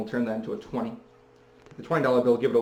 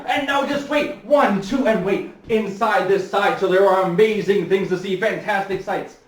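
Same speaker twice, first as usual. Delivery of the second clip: much higher, loud, stretched-out ballyhoo; barker hype; 7 semitones higher, 8 dB louder than usual. Retold or imitated. Barker luring people into his castle of illusions, loud high ballyhoo. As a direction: hype the wonders inside, drawing every word out. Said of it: "Then he'd say".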